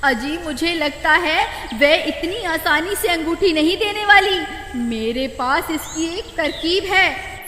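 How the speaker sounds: high woman's voice; hint at a clever plan; scheming wife. Acted. Wife character voice.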